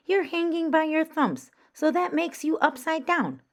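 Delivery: high, loud bat voice; a bold bat explaining the obvious; bat creature voice. Acted. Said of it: "the creature said".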